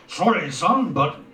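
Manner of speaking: high-pitched, pushy dad voice; father's retort. A narrator's reading. Father mockingly claims to like it.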